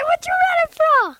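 high-pitched alarmed question; urgent call. Acted. Boy calling to his brother running from ghosts, high.

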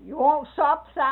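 loud, high mock call; Becky calling to Silas, loud and high; Becky's call. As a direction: belt it out like a hostess.

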